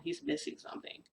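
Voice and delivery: breathy whispered remark, under the breath; whispered aside. Real, not acted. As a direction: let it slip out as a hushed aside. Whispered aside mid-story.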